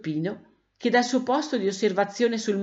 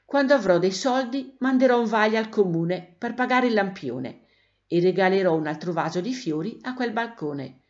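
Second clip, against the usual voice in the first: ordinary delivery, nothing marked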